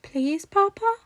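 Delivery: high begging little voice; pleading child. A narrator's reading. Little boy begging his father to help the stranger, high and pleading. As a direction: plead with big eyes like a toddler. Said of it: "tug on his sleeve".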